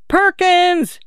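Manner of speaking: high, drawn-out name call; sing-out call without full volume. A narrator's reading. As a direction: call for someone missing, pitched high. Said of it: "she called out".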